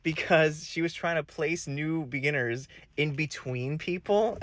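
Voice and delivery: usual speaking voice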